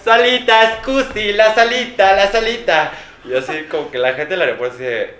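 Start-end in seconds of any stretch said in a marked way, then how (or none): 0.0-2.9 high, loud, insistent asking; a lost tourist begging airport staff, high and loud; pleading tourist. Retold or imitated. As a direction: repeat the wrong word louder and louder.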